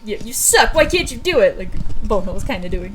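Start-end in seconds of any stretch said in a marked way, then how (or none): 0.0-1.6 mock berating. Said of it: "I'm not trying to be like".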